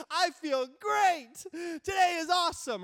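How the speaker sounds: high, loud, gushing cheer; over-the-top upbeat holler; exaggerated enthusiasm. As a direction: shout it like a row of exclamation marks. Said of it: "Here's the tone. Here's how I feel".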